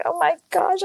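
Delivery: high, panicked squeak of alarm; flustered gasp. Retold or imitated. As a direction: yelp it out high, caught off guard. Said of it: "And I was like".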